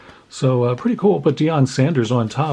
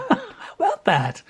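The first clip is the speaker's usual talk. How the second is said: laughing; slower than usual. Real, not acted. Laughing after a good pull.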